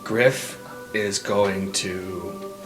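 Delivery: ordinary talk, nothing marked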